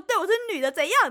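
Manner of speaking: high, loud so-what retort; defiant retort. Retold or imitated. Answering the opponent, loud defiant so-what. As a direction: throw the challenge back.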